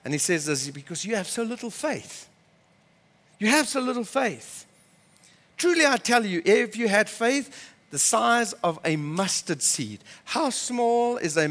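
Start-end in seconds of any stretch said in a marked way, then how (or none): 0.7-10.0 softly acted calm explanation; calmer. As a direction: tell them gently, with slight reproach. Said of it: "he's a bit calmer now".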